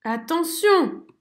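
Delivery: cri lent, aigu et fort; hurlé. A narrator's reading. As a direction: avertir d'un cri. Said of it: "hurle Zigomar". (merle qui hurle un avertissement)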